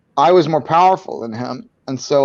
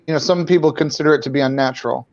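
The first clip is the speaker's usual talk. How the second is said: usual speaking voice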